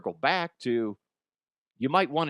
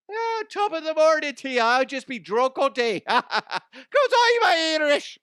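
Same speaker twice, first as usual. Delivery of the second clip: high, loud stage-Irish with laughter; 13 semitones higher, 5 dB louder than usual. Acted. Irish character pitching himself for president, loud high Irish brogue. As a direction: ham up a jolly drunk Irishman.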